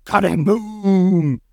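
loud, drawn-out vocal blast; imitated explosion boom. A narrator's reading. Narrator voicing the gas bomb, booming explosion.

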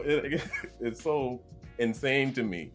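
ordinary talk

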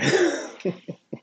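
high wheezing burst of laughter; real cracked-up laugh, way up high. Real, not acted. Host cracking up at his own army story, high real laugh.

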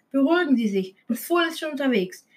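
ordinary delivery

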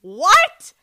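high, loud shocked cry; mock audience shock, shrieked; loud, high incredulous what.

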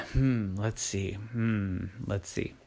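low, soft musing; pondering dad. Acted. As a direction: hum and think to yourself. Daddy thinking it over.

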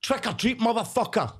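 high mock-aggressive doorstep demand; cheeky high-pitched mock threat; brash kids of today. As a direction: demand it high and pushy, hammed-up. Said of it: "I think they go up and go".

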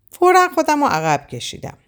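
ordinary delivery, nothing marked

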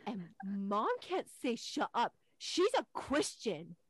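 slow, loud, emphatic kid imitation; scandalized tattling. Son telling dad on mom, scandalized.

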